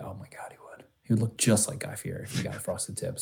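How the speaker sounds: breathless whispered aside; whispering. Real, not acted. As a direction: whisper it, amused and secret. Amused aside about Guy Fieri.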